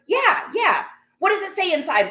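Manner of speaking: high, lightly mocking busybody; pushy insistence. Karen asks shopper what the bag says inside, pushy.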